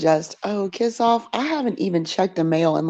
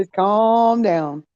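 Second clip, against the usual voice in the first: slow calm down; 4 semitones higher, 4 dB louder, much slower than usual. Pretend. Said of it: "voicing the rooster's plea".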